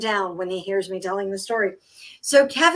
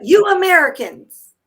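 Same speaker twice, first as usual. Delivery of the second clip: mock scolding local; 7 semitones higher, 7 dB louder than usual. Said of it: "they'll be like".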